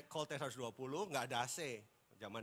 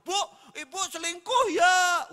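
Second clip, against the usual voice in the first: mock blunt accusation; 19 dB louder, slower than usual. Preacher acts blunt question to wife.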